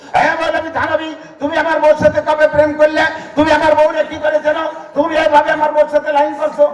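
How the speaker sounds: high-pitched, indignant accusing questions; jealous accusation. Retold or imitated. Husband accusing the maulana of courting his wife, high indignant.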